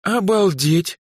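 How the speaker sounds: high, stunned exclamation; flabbergasted girl's outburst. A narrator's reading. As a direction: marvel in disbelief, pitch way up. Stunned by his ignorance.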